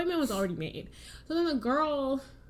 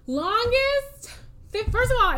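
high, louder exasperated outburst; 12 semitones higher, 4 dB louder than usual. Real, not acted. Telling the cohost about the wait, exasperated and high. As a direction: complain loudly at the long wait.

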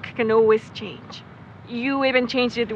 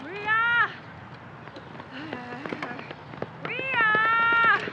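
calling from afar; 10 semitones higher, 6 dB quieter than usual. Acted. Calling her from a distance.

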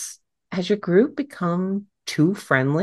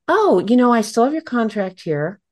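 louder, higher "oh" of recollection; sudden remembering; 7 semitones higher, 5 dB louder than usual. Retold or imitated. Attorney to a client about a forgotten contract, suddenly remembering, raised voice. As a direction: blurt it out as if just reminded.

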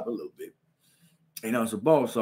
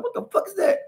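much higher startled cry. Retold or imitated. Hit from behind, a high startled question. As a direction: jolt at being hit. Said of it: "I'm like".

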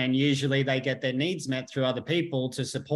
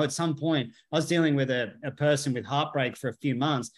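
usual speaking voice

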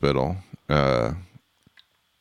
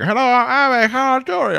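put-on high, loud thick accent; mock heavy European accent; thick foreign accent; 5 dB louder than usual. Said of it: "such a thick accent".

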